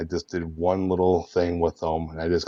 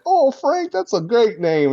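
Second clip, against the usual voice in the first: very high, eager nerdy voice; nerdy news guy voice; 5 dB louder than usual. Acted. Complimenting Frank's name, very high eager nerdy voice. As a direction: gush over the name. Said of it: "I'm like".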